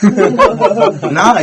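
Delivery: real laugh spilling into the word, high and loud; can't hold back the laugh. Real, not acted. Laughs at the punchline.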